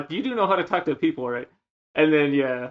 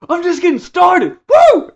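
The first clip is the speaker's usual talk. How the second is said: much higher, loud whooping yell; drunk party shout; 11 dB louder than usual. Retold or imitated. Drunk friend dancing at the party, whooping shout. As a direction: shout it like a drunk hyping himself up. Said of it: "he'd be like".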